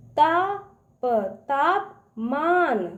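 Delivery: तापमान stretched slowly, syllable by syllable; sounding out syllables. Shown as a demonstration. Teacher reads a word in pieces for children, slowly. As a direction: break तापमान into slow parts. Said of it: "उसको तोड़ तोड़ पढ़िए".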